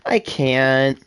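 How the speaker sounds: normal talking voice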